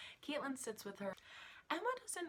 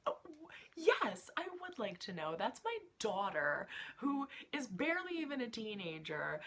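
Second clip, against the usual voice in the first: indignant; 6 semitones higher, 5 dB louder than usual. Real, not acted. Angry parent defending daughter.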